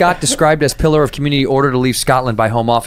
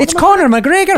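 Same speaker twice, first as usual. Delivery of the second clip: Irish accent; 14 semitones higher than usual. Shown as a demonstration. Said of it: "no way to not say his name Irish".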